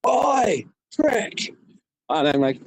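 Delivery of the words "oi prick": high friendly yell across the street; mock shout, pitched high; mate's call. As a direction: call out cheekily to a mate. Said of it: "and he goes".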